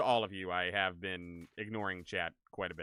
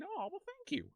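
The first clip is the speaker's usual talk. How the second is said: flattered squeal; 7 dB quieter than usual. Real, not acted. Flattered by chat compliment.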